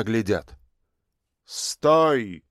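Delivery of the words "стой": hard metallic command. A narrator's reading. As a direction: bark it like a machine. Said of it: "услышала Люся металлический голос в спину".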